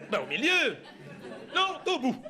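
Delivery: high, loud acted bickering; exasperated squabble. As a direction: argue indignantly over the map. Acted squabble over the park map. (dispute jouée, exaspérée et aiguë)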